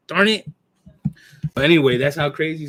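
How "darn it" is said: annoyed. Retold or imitated. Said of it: "I'm like".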